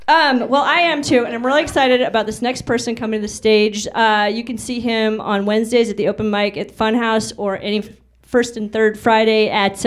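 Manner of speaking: ordinary talk, nothing marked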